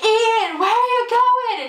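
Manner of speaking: high, slow, drawn-out call across the pit; calling out to him in a stretched, high voice; sing-song high yell after someone leaving; mock loud call. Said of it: "she just goes".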